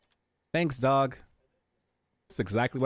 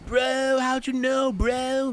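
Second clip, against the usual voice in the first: much higher, amazed bro voice; teen bro impression; 12 semitones higher, much slower than usual. Streamer's teen answering his friend, high amazed bro voice. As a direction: squeak it like an awed teenager. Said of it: "That's my impression of teens".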